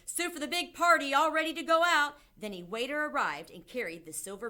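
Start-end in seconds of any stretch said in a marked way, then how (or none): 0.1-2.1 loud, high kitchen call; cook's shout. A narrator's reading. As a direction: call the order out loudly across the room. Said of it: "He put the lid on the basin and shouted".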